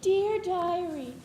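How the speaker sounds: high, slow whiny diary voice; mocking sister voice. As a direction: mimic a whiny teen reading her diary. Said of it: "I do not sound like that".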